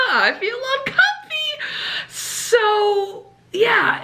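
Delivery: high, laughing through discomfort; giggly and uncomfortable; nervous laugh. Real, not acted. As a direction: laugh it off, uneasy. Laughing nervously about creepy guy.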